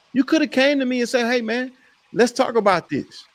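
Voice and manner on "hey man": ordinary talk, nothing marked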